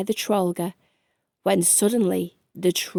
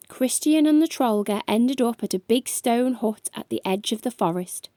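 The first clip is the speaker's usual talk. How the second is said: ordinary delivery, nothing marked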